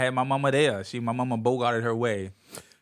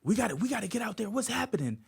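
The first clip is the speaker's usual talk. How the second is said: alarmed recruits; 11 semitones higher, faster than usual. Retold or imitated. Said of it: "they was like".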